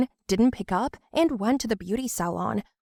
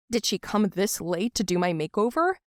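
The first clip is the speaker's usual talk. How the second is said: plain reading voice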